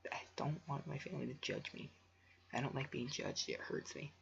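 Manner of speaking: soft unvoiced whisper; embarrassed hushed whisper. Real, not acted. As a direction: confess it under your breath.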